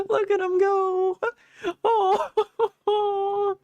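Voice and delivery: high, slow, squealy coo; gushing. Real, not acted. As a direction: coo over something adorable. Gushing over cute character.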